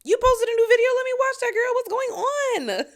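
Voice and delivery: high, loud and thrilled; excited viewer. Retold or imitated. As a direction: squeal like a fan spotting a new upload. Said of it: "they're like".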